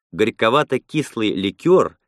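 usual speaking voice

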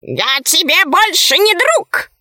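high, slow, huffy bird voice; offended talking bird. A narrator's reading. Right head breaks off friendship with the dog, huffy bird. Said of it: "обиделась правая голова".

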